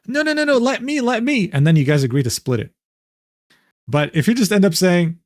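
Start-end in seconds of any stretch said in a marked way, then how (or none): 0.1-1.5 high-pitched, over-polite protest; mock insistence. Friend pretends to fight over the bill, mock insistent. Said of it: "He's expecting you to say".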